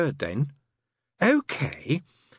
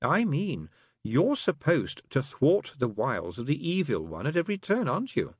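slow, higher, wheedling demon; sly; 9 semitones higher, slower than usual. Acted. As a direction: lead him on slyly. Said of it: "Crowley looked up slyly".

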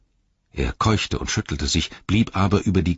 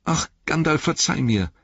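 high, plaintive hobbit voice; pleading for forgiveness; 12 semitones higher than usual. A narrator's reading. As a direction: beg the wizard to forgive you. Frightened hobbit begs forgiveness. (flehend, mit hoher Stimme)

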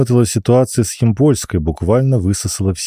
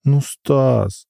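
sniffly whine; 5 semitones higher than usual. Acted. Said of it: "Алина шмыгнула".

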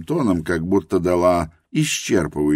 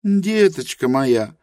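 Helena's voice. A narrator's reading. Helena admits her ignorance to Rosa, high and earnest. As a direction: confess humbly in Helena's high voice.

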